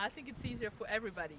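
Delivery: ordinary delivery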